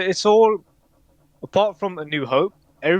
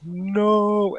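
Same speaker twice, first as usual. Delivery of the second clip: long-drawn, higher-pitched "no"; mild mock scream; 8 semitones higher than usual. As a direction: imitate a movie scream without really shouting. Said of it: "And then he screams".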